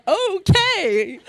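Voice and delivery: high, rising okay; forced agreement. Retold or imitated. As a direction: agree brightly against your will. Reluctantly agrees to try them on.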